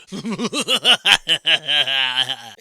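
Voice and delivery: loud hammed-up laugh; a big gloating rich man's laugh; mock tycoon laugh. Mocking [name] with a loud gloating laugh.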